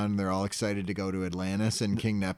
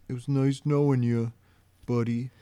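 imitating a sad goodbye, raised a little; mock tearful farewell; 3 semitones higher than usual. Retelling Patrick's goodbye to his friend, mildly mock tearful. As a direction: play a weepy friend, lightly. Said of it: "He's crying, wiping his tears".

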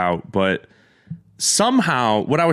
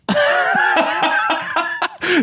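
burst of laughter. Real, not acted. Laughing at own story.

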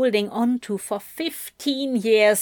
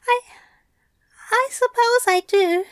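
hesitant; 13 semitones higher, 5 dB louder, faster than usual. A narrator's reading. Aziraphale concedes, hesitant.

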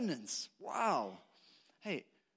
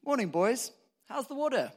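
a hint of a cheery old fish, louder and higher; old fish greeting; 5 semitones higher, 7 dB louder than usual. Older fish calling out to the young fish, lightly voiced cheery greeting. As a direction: hail the young fish brightly like a friendly elder. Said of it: "who nods at them and says".

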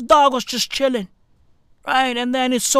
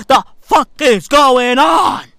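loud, raised mock-baffled shout; hammed-up astonishment, louder and rising at the end; bewildered outburst; 3 semitones higher, 5 dB louder than usual. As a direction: demand an answer in acted disbelief. Acted bewildered outburst at neighborhood.

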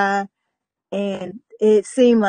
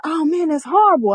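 raised, louder retold indignation; sympathetic outrage; 6 semitones higher, 4 dB louder than usual. Roommate hearing about the cheating, in mock-indignant sympathy. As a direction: get worked up on a friend's behalf. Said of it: "I'm getting mad with her".

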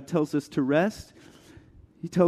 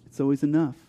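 ordinary talk

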